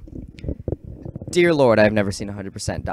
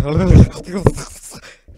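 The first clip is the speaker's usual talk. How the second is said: loud, high garbled gurgle; ugly excited noise; 8 semitones higher, 11 dB louder than usual. Retold or imitated. Student too excited before a concert, ugly noises. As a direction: let out an ugly demon noise. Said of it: "making these ugly ass noises".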